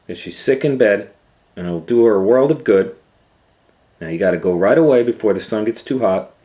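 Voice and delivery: loud, bossy mom listing orders; instructing mother. Acted. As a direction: lay down the rules like a fussy mom. Mother instructs daughter at length.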